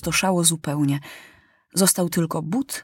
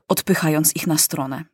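plain reading voice

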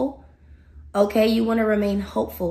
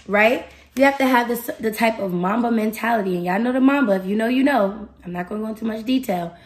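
normal talking voice

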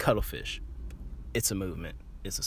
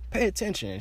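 firmer scolding; 10 semitones higher than usual. Retold or imitated. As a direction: tell her Pay attention as a final warning. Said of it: "I'm like".

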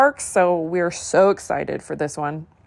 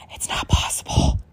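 whispered aside. Real, not acted. Vlogger confiding to viewers about the evil queen, whispered. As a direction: drop to a breathy whisper, like a secret.

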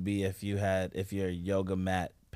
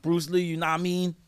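put-on Brooklyn accent; 7 semitones higher than usual. Repeating the Brooklyn bit.

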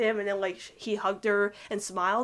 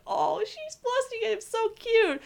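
much higher, cooing over how cute they are; gushing fan squeal; 12 semitones higher, slower than usual. Retold or imitated. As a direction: coo like a swooning shipper. Fan voices her own inner gushing.

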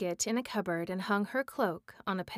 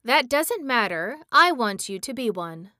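put-on louder, firm old witch; stern old woman; 3 semitones higher, 7 dB louder than usual. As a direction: lay down the law to the boy. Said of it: "looking at him severely".

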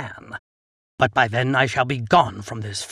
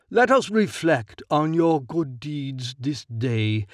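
slow, raised preacher's voice; sermon; 4 semitones higher than usual. A narrator's reading. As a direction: preach the parable to the court. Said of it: "his sermon".

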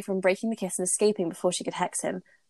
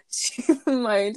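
half laugh; 6 semitones higher than usual. Real, not acted. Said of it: "that was a half laugh".

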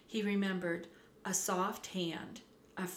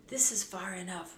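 plain reading voice

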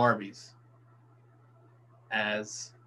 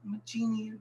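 high, soft pop-star voice; light, high singer imitation; 6 semitones higher, 6 dB quieter than usual. Riffing on the chicken order, soft high impression.

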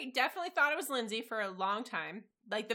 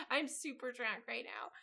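normal talking voice

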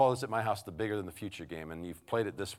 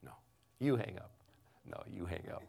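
dating sweethearts; 5 dB quieter than usual. Acted. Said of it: "it sounds like this".

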